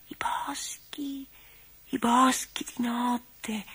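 slow, breathy dreaming whisper; dreamy whisper. Acted. Doll Dora by the fire dreamily recalling the night woods, whispering. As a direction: whisper it as if half asleep. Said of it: "con voce sognante ripeteva pianino".

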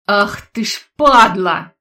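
loud, slow furious curse; angry outburst. Acted. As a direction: lash out in fury. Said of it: "вскинулся старик".

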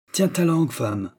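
roughly spoken order, high at the start; rough, curt. A narrator's reading. Husband silencing his wife, rough. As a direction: silence her brusquely. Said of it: "dit-il rudement".

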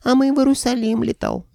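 very high falsetto dragon head. A narrator's reading. Dragon head telling the hero of its trip, falsetto. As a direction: pipe it up in a cartoonish falsetto. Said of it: "заговорила фальцетом".

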